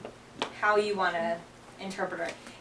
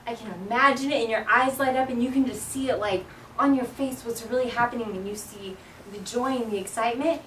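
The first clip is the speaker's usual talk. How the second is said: expressive, wonder-filled. Shown as a demonstration. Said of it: "Or I can be like".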